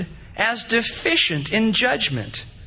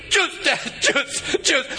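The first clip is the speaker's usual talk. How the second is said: put-on a child nagging, voice higher; pestering toddler; 5 semitones higher, slower than usual. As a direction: keep nagging like a little kid.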